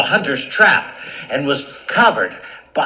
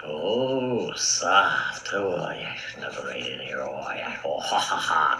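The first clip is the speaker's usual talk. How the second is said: low, soft, breathy mumble; sleepy big lion, half whispered; sleep-talking lion; 7 semitones lower, 5 dB quieter than usual. Acted. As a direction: murmur drowsily as if deep in a dream.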